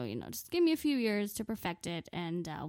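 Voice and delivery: ordinary talk, nothing marked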